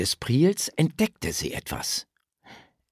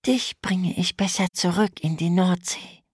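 zärtlich; 8 semitones higher than usual. A narrator's reading. Mädchen spricht sanft zum Krebs.

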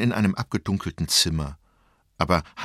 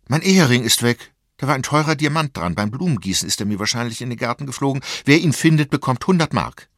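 höher, lauter, aufgeregter Ausruf; aufgeregte Mutter; 5 semitones higher, 6 dB louder, faster than usual. A narrator's reading. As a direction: wie eine aufgeregte Mutter, die alle zum Suchen antreibt. Mutter meldet verlorenen Ehering. (aufgeregt, mit erhobener Stimme)

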